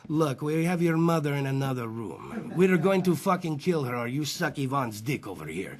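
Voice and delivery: put-on low, menacing Russian mobster accent. Comic voicing a Russian mobster threatening him, menacing Russian accent. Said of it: "Russian mobster runs up on you".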